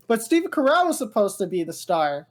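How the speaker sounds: ordinary talk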